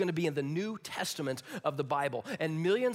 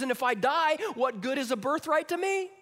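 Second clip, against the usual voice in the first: whiny Esau; 8 semitones higher, 5 dB louder than usual. Acted. Acted Esau.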